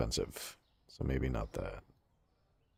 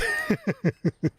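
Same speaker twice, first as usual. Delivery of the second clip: sudden high-pitched giggle; real amused giggle at her own joke. Real, not acted. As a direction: burst into a squeaky laugh. Laughs at own boast.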